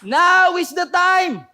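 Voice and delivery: full-force battle shout; high, drawn-out command to an army; Joshua. Acted. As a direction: roar the order, stretching each word. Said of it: "sinabi ni Joshua sa mga Israelites".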